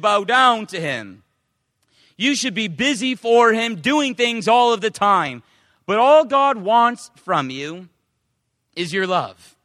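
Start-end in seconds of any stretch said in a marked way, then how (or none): none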